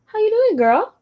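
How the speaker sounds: high, loud pet-owner coo; dog-talk voice. Real, not acted. Owner checks on her dog, high excited pet-talk voice.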